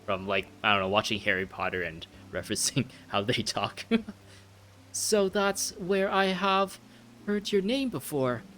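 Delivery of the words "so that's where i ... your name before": high, slow put-on British voice; earnest British prince, pitched up; wing a high British accent, phrase by phrase. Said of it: "continue the accent just so I have more practice".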